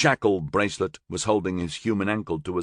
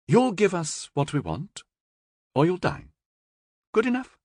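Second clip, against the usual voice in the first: higher, crisp director's ultimatum; cool female director; 12 semitones higher than usual. A narrator's reading. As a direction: lay down terms with a faint smile. Yaki voiced high.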